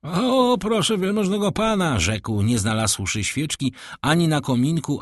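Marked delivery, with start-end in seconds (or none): 0.0-2.0 put-on high, gushing old servant; fawning doorkeeper. A narrator's reading. Grateful doorkeeper blesses the count, high and gushing. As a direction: gush with thanks, voice pitched up. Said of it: "rozpłynął się w błogosławieństwach".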